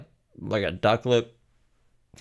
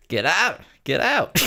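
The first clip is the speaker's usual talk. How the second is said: high, drawn-out ghostly get out; spooky get out; 10 semitones higher, 3 dB louder than usual. Pretend. Streamer voicing the signs telling her to leave, ghostly high. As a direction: moan it like a haunted house warning.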